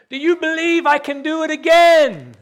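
high, loud call across the falls; yelling to the crowd. Retold or imitated. Tightrope walker calling to the crowd, loud yell. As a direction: shout it out to a big crowd. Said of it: "he yells to them and he says".

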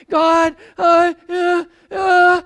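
loud, straining, stuck on uh; a believer crying out to God; anguished prayer shout; desperate cry. Acted. Voicing a struggling believer's prayer.

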